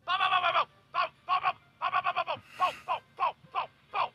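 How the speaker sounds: high, rhythmic "bow, bow" dryer thuds; imitated thudding dryer. Shown as a demonstration. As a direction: mimic shoes banging in a dryer. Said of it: "it sounds a lot like this".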